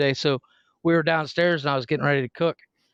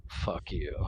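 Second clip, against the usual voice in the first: much lower, soft deadpan curse; deadpan daughter; 11 semitones lower, 12 dB quieter than usual. Retold or imitated. Grumpy five-year-old glaring at dad, low soft deadpan. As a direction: drop it low and flat like a grumpy kid. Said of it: "My daughter's just like".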